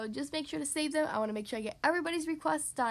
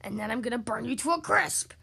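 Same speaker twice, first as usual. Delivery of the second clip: mock bellow swelling on the last words; bellowing dragon; 3 semitones lower, 4 dB louder than usual. Dragon finishing its threat to burn him, building bellow. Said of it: "bellowed the dragon".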